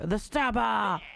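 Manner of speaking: lightly dramatic cry of alarm; name the culprit with a touch of drama; hammed-up exclamation, held in; mild acted exclamation. Acting out wounded visitor's cry.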